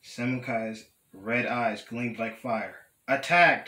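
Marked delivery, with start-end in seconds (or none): 3.1-3.7 louder, higher storybook battle cry; yelled command. A narrator's reading. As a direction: call it out like a leader ordering a charge. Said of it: "he yelled".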